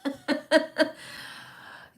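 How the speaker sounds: breathy high laugh; high burst of laughter. Real, not acted. Mom laughing about her own teaching limits, breathy laugh. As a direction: laugh at yourself.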